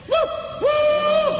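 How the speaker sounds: very high hooting call; the beast hooting back close by; bunyip reply, close. Retold or imitated. Hunter imitating the bunyip's answer close at hand, very high hoot. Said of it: "it's coming closer".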